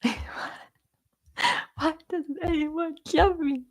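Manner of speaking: high, drawn-out fake whine; mock crying wail. Joking after talk of French people, hammed-up misunderstood wail. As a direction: wail it slowly, playing at being heartbroken. Put-on wail amid laughter.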